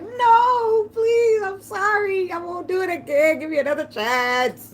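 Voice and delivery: high, crying please, slowing at the end; mock crying plea. Clinging to his leg, high crying plea. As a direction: beg like you're sobbing on his leg.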